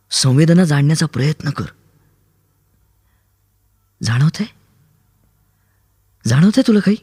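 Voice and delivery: a young woman's voice, lightly voiced, patient and urging; Kaumudi coaxing gently. Kaumudi teaching Aru to sense the world, gently urging. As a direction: coax him to see with his mind.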